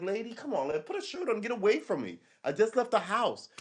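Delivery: high, fed-up complaint at a stranger; high, weary mock exasperation; exasperated plea. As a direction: beg her to cover up, annoyed. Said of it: "you're like".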